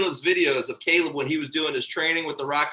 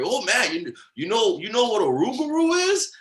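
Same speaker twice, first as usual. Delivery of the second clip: higher-pitched imitation of a friend's accent; Keith's accent; 6 semitones higher than usual. Imitating Keith quizzing his friend, in Keith's accent. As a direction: do your best Keith accent, lifting into the question. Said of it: "doing my best Keith accent here".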